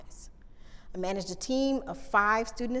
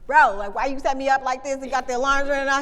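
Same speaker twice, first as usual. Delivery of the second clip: louder, higher exasperated complaint to a colleague; exasperated scolding; 4 semitones higher, 9 dB louder than usual. Retold or imitated. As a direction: chew out your boss, half joking. Scolding the director about alarms.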